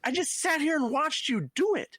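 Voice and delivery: lightly voiced fed-up adult; mock exasperated parent. Adult catching a kid, high and incredulous.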